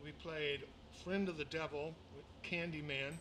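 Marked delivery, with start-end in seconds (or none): none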